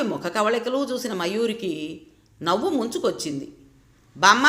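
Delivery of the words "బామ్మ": a sharp yell, much louder. A narrator's reading. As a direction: bellow the word బామ్మ at her. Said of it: "గావు కేక పెట్టాడు".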